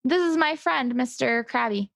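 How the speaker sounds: normal talking voice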